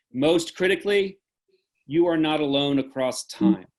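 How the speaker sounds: measured, repeated declaration that swells and sinks; solemn benediction. Acted. Director closing the Zoom premiere with a solemn benediction. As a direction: intone each line like a blessing over the audience. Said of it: "the benediction at the end of every Theater of War performance".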